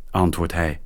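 ordinary delivery, nothing marked

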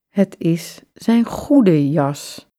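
usual speaking voice

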